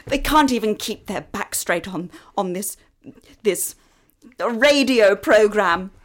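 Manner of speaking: indignant. Acted. Outraged declaration to audience.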